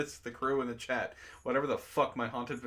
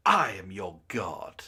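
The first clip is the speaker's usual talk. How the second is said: dramatic god voice; 7 semitones lower, 3 dB louder, slower than usual. Shown as a demonstration. Streamer performs the line at a friend's request, slow and godlike. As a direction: lower and slow, like a proclaiming deity. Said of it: "Fine, you can say it".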